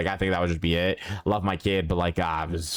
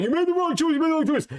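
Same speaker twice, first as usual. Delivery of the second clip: much higher, squeaky mock of nagging viewers; 3 dB louder than usual. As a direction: whine it like a know-it-all fan. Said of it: "viewers are like".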